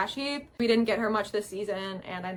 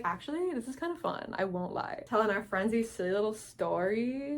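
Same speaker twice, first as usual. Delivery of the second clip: quiet; 9 dB quieter than usual. Retold or imitated. Voicing Boober quietly.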